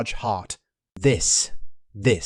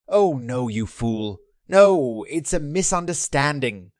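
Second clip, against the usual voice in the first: higher, louder burst on the denial; panicked protest; 3 semitones higher, 4 dB louder than usual. Acted. Accused hunter denies theft to the king, panicked. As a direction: blurt out an alarmed denial, pitch jumping up.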